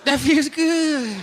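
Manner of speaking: high, drawn-out moan of pleasure; orgasmic moan. Acted. Bereaved man climaxing while being offered condolences, orgasmic moan. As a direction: moan it helplessly, stretching every word.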